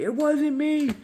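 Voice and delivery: very high whiny protest; whiny denial. Retold or imitated. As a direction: squeak out the denial. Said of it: "yelling at this kid".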